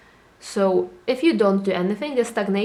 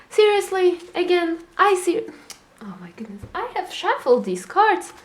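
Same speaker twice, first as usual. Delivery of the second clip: real surprise; 9 semitones higher than usual. Real, not acted. Reader sees a card repeat, surprised and high. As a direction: react as the same card turns up. Same tarot card comes up again.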